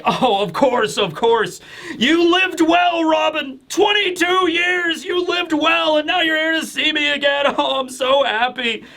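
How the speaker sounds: put-on a huge beaming old friend, laughing at the end; Saul's joyful voice. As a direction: boom out an overjoyed welcome, up high. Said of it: "And then Saul is like".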